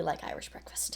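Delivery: whispering. Real, not acted. Confiding tea preference.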